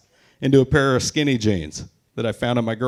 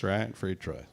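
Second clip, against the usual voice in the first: ordinary talk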